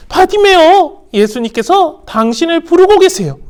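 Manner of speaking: high, eager call; mildly excited news; calling out to him. Acted. People telling Bartimaeus Jesus calls him, eager call.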